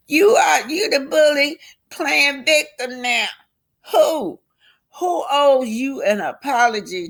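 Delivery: high, indignant accusation; confronting. Acted. Friend confronting Tisha about playing victim, high and indignant.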